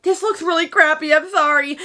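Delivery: lightly voiced embarrassed apology; sheepish author. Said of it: "they're like".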